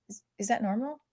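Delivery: normal talking voice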